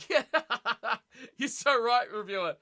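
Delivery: laughing through agreement. Real, not acted. Agreeing with the reviewer while laughing. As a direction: let the laugh spill into the words.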